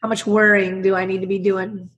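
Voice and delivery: ordinary delivery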